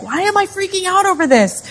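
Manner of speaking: very high, anxious squeak of a question; mock freak-out. Anxious job applicant questioning herself, mock-panicked. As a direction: shoot the pitch up in hammed-up panic. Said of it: "my anxiety is rising and rising".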